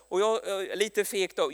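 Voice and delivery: ordinary delivery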